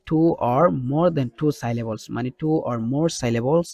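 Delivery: slow, deliberate rule-giving; slow teacher explanation; slow explaining. Shown as a demonstration. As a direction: lay out the rule word by word.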